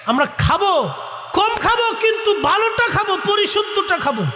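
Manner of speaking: high-pitched exhortation, every phrase driven hard; rallying cry. Real, not acted. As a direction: cry it out high to rouse the congregation.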